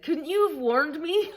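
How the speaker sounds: high, loud imitation of an exasperated friend; mock protest. Best friend phones next day, mock protest. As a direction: act out a friend's indignant complaint.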